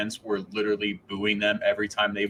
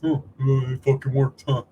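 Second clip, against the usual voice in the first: higher and a bit louder, a sheepish "oh, uh"; sheepish coach impression; 5 semitones higher, 4 dB louder than usual. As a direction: play the coach caught out, mildly hammed. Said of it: "And he's like".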